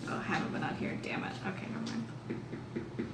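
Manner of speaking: ordinary delivery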